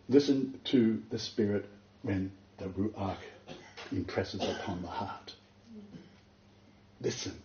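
soft, slow and intimate; hushed urging. Real, not acted. Preacher urging the congregation to hear the Spirit, hushed. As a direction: draw them in quietly.

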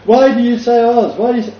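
high, loud mock questioning; puzzled emailer. Imitating the emailer asking about Oz, mock-puzzled. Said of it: "write back and go".

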